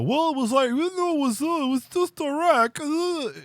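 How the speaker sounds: very high, squeaky parody voice; high mocking impression of a dismissive leader; mock Iran voice. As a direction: squeak it out like a shrugging cartoon. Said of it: "sit there and go".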